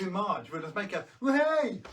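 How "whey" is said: high cheer; excited whoop. Real, not acted. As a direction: let out a whoop. Celebratory exclamation.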